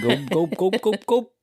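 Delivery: cartoon swallowing noises, rising; cartoon gulping. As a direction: gulp like a cartoon character. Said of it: "so cartoon-like".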